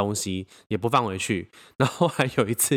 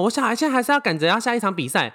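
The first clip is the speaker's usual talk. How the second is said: mock insistent mom; 11 semitones higher than usual. Mother insisting angrily.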